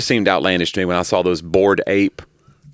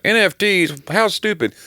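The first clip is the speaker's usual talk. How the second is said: mock shouting crank; 10 semitones higher than usual. Comedian mimicking a guy shouting at the clouds, high mock outrage. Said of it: "guy that just shouted at the clouds like".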